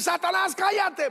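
high, loud joking command; mock shouted rebuke. Husband wrongly rebuking his yelling wife, mock shouting. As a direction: bellow it like an overzealous exorcist. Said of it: "Cuando tu mujer te empieza a gritar, no le vayas a decir".

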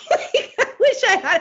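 high, laughing through it. Real, not acted. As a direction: laugh while you say it. Laughs at her own wish.

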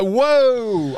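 long, high-pitched whoa; mock panicked whoa. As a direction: draw it out high in pretend panic. Acts out his panicked reaction.

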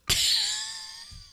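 high wheezy laugh; wheezing laugh. Real, not acted. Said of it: "can't be doing the wheeze laugh now".

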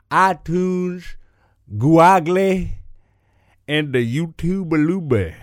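slow, loud, high hyped-up announcer; slow announcer list. Acted. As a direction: stretch each platform name like a show host.

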